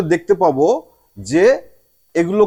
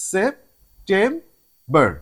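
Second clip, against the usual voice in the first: "September" slowly, in three parts; drawn-out three-beat "September"; split into syllables; much slower than usual. Shown as a demonstration. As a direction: separate it as "সেপ-টেম-বর". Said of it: "তিনটা part আছে".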